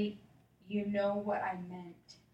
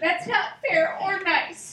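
scolding; 7 semitones higher, 9 dB louder, slower than usual. Acted. Character scolds laughing friends.

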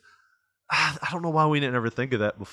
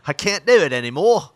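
English accent slipping into Australian, louder and higher; failed English accent; 4 semitones higher, 6 dB louder than usual. Shown as a demonstration. Showing a co-host a lost skill, failed English accent. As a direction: attempt English, land on Australian. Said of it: "Now that's Australian".

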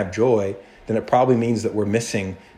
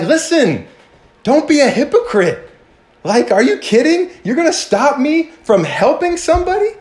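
much higher, louder, incredulous telling-off; exasperated rebuke; 12 semitones higher, 4 dB louder than usual. Acted. Jesus telling off the hypocritical ruler, exasperated and loud. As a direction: blow up at a hypocrite in disbelief. Jesus told as modern retort.

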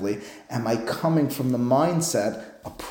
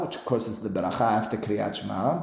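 ordinary talk

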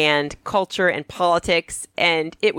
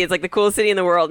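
ordinary talk, nothing marked

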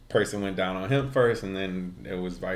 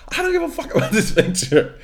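high, fast, raised-voice rant with a laugh; imitated mad yell; 5 dB louder, faster than usual. Storyteller replaying the woman's anger at the picture, mock yelling. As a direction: yell it like she's fed up. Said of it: "She yells out loud".

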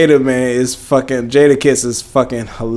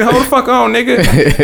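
pitched-up offended outburst; mock outrage; 8 semitones higher, slower than usual. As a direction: act offended and ready to retaliate. Retold offended reaction.

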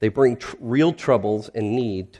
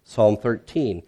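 normal talking voice

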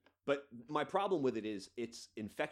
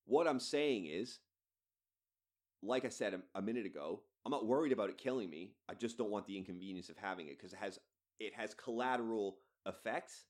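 ordinary talk

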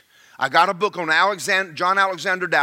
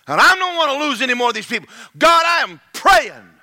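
high, loud cry, slowing into a shout; desperate shouted prayer; 9 semitones higher, 7 dB louder than usual. Retold or imitated. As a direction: cry out to God demanding help. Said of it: "And he said".

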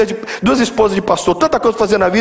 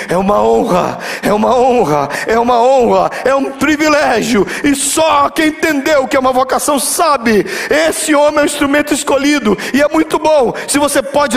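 preaching that climbs higher with each phrase; pregação em crescendo; 7 semitones higher than usual. Real, not acted. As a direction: build from level to high, impassioned proclamation. (pregação que vai subindo de tom)

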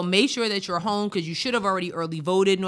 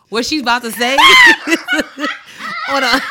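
laughing shout; 11 dB louder than usual. Real, not acted.

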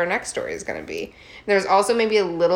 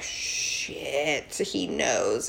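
caught muttering; 5 semitones lower, 9 dB quieter, much slower than usual. Retold or imitated. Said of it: "Adam and Eve were like".

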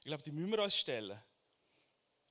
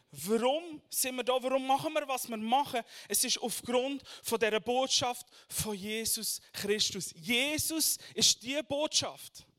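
forceful preaching, higher and louder; 6 semitones higher, 8 dB louder than usual. Acted. Preacher proclaiming to the congregation, forceful preaching.

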